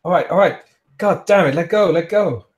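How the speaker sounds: high, urgent pained protest; crying out in pain. Acted. Man with his arm gripped hard, crying out in pain. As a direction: beg and curse to be released. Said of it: "cries out in pain and goes".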